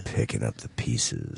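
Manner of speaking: low, lingering radio delivery; smooth radio voice. Shown as a demonstration. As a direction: lean into the deep DJ tone. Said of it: "It's my radio voice".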